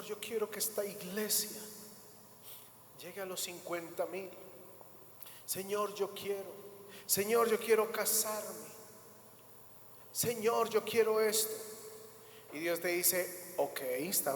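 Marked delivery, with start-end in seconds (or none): none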